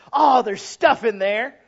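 loud, slow wonder at a find; excited discovery. Acted. To the congregation, a loud slow awed discovery. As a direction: act out finding treasure. Preacher acts finding box.

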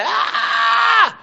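put-on sudden high shriek like a fussing baby; baby wail. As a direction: shriek like an upset infant. Baby crying out.

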